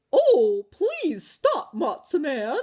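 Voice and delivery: high sweet coaxing coo. Acted. Auntie Bertha cooing at the matzah man to stop. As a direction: coo at him like a doting aunt. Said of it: "cooed Auntie Bertha".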